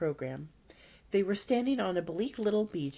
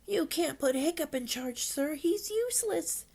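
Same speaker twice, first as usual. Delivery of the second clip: high-pitched whiny objection; acted boyish protest, climbing higher; groaning protest; 10 semitones higher than usual. A narrator's reading. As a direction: complain up high to the officer. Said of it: "groaned Dog's Breath the Dubrain".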